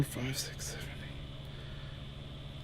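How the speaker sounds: counting under his breath, very soft; whispered counting. Real, not acted. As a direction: whisper the numbers to yourself, slowing down.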